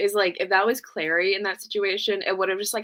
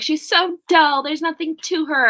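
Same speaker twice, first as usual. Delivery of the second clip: dismissive scoff; 8 semitones higher, 5 dB louder than usual. Retold or imitated. Retold dismissive thought.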